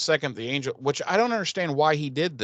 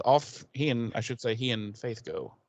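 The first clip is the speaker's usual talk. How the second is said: usual speaking voice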